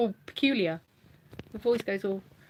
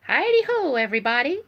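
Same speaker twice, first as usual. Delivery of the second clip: Mr Hankey voice; 4 semitones higher, 5 dB louder than usual. Retold or imitated. Doing Mr Hankey for her thumb puppet, high and cheery. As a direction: sing out his cheery catchphrase. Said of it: "Mister Hanky the Christmas Poo".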